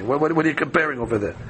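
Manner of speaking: fast stammering what-what-what, mild mock indignation; rapid sputtering protest. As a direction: fire off the repeated what like a flustered rabbi. Same protest repeated.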